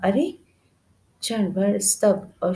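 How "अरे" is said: a sharp, high "arre!" of surprise; startled cry. A narrator's reading. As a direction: caught off guard, blurt it out. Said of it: "उस लड़की ने चौंक कर कहा".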